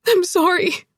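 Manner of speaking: high, tearful apology; choked. A narrator's reading. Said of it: "Zoe choked".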